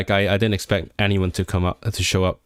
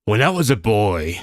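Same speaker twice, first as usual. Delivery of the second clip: Kingpin. Acted. Streamer mimicking a Daredevil villain, half-successful impression. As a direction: attempt a film villain's voice on the line. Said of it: "I'm the Kingpin now from Daredevil".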